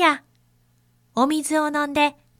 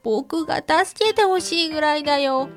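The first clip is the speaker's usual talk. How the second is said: put-on sad little boy, close to crying; a touch of tears in a child's voice; tearful young zebra; 3 semitones higher than usual. A narrator's reading.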